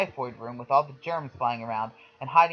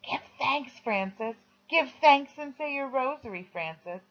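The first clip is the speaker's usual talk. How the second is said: girl mimicking the nun, higher; mock nun; 9 semitones higher than usual. A narrator's reading. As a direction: tease him in a mock sister voice. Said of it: "Patricia whispers".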